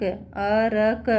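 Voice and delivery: अरक slowly split as "a-ra-k"; separated sounds. Shown as a demonstration. Teacher shows pupils how it is not said, अरक in separate sounds. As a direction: pull अरक apart, giving र its own vowel. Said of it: "अलग अलग न-, नहीं आ रहा".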